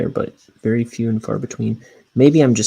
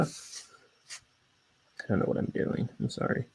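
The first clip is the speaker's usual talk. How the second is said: whispering; 13 dB quieter, slower than usual. Real, not acted. Embarrassed after silly impression.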